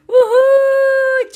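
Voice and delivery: high loud whoop of joy; excited whoop. Real, not acted. YouTuber cheering as the battery fills, excited whoop. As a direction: whoop like you just won.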